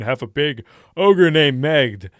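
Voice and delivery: loud, booming ogre voice; big ogre. Shown as a demonstration. Introducing an ogre character to players, big booming voice. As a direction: boom it out like a huge ogre. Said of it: "I always go for low voices".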